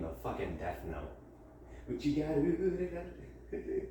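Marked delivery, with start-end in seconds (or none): 2.7-3.9 higher, softer put-on voice. Mocking herself over the feedback form, higher, softer put-on voice. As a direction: repeat it in a silly, higher, quieter voice.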